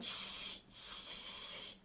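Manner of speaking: whispered shushing. A narrator's reading. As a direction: hush her in whispers. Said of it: "the Hatter and the March Hare went".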